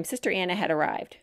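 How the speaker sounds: ordinary delivery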